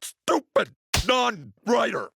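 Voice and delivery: high, slow, furious yell; angry outburst. Acted. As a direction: snap at him, high-pitched. Outburst at Brian.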